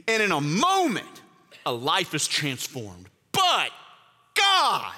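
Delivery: high, urgent preaching push; fervent preaching. Acted. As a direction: drive it home to the congregation. Preaching at full force.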